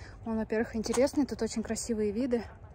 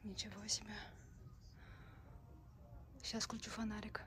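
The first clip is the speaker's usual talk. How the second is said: hushed; 16 dB quieter than usual. Real, not acted. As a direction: keep it hushed and wary. Hushed talk in dark tunnel.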